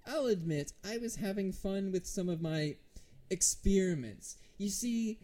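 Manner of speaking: high, loud theatrical villain; self-important villain, rising into relish; necromancer. Acted. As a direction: proclaim it grandly to the group. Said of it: "the group around him and says".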